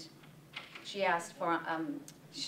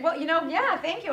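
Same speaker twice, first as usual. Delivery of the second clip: excited; 9 semitones higher, 7 dB louder than usual. Retold or imitated. Flattered reply to chef retold.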